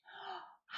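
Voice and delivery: sharp, high, breathy intake of delight; excited gasp. Real, not acted. Gasping at news of a Dahl reading, delighted. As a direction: gasp like you just heard great news.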